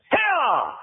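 high, drawn-out cry that drops at the end; high falling command out of nowhere; sudden sharp command. Retold or imitated. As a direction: startle the child with one high-pitched call. Acts out hushing a screaming child.

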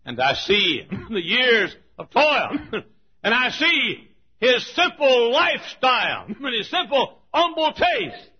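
high, loud and slow, ringing out; fervent revival sermon cry; preaching at full force. Acted. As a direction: preach it to the rafters.

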